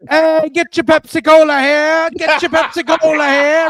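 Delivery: loud, high hawker's call; stadium vendor call. Retold or imitated. Soda seller working the concert crowd, calling out loud. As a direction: shout it to the stands like a soda vendor. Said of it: "you about to be selling some sodas".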